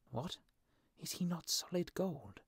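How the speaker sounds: hushed, high-pitched surprise; aside to himself. A narrator's reading. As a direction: think it aloud, softly and high. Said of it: "said the swallow to himself".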